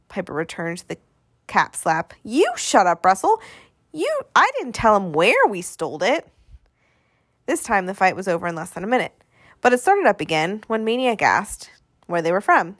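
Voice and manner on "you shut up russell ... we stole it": put-on indignant little boy, pitch leaping up; childish bickering, higher on the stressed words; squabbling kid retort. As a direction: argue back like an offended kid. Boy snaps back in quarrel.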